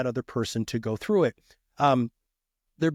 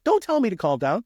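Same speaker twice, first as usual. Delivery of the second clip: higher and a bit louder, still a half-hearted snap; mock angry retort; 6 semitones higher, 3 dB louder than usual. An angry witness refusing to be calmed, in mock anger. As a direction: push back like an upset witness. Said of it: "Maybe it's angry".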